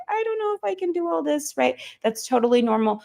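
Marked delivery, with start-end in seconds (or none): 0.1-1.6 much higher, small worried voice; doubtful inner voice. Retold or imitated. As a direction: play the anxious little voice inside. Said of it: "a little bit of like".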